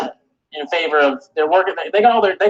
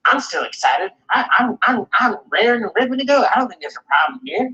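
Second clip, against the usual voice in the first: mock Gabe; 7 semitones higher than usual. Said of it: "Gabe's always just like".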